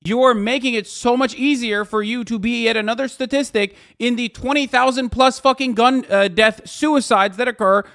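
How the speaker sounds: loud, high yelling at chat. Real, not acted. Streamer yelling at chatters about guns and safety, loud. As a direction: let it rip, heated.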